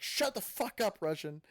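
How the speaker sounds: mock yell, high and loud; exasperated outburst. Brendan telling Russian to shut up, exasperated yell. Friend snaps at the fake Russian.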